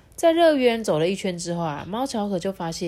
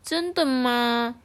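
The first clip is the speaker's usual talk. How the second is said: doubtful question; 5 semitones higher, much slower than usual. Acted. Character asks doubtfully.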